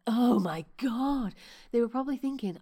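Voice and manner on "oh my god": mock shocked gasp. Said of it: "the other clients weren't like".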